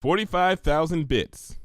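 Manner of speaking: usual speaking voice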